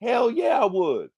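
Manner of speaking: high, sudden hell yeah; excited shout. Real, not acted. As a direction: burst out with eager agreement. Eager answer about being paid.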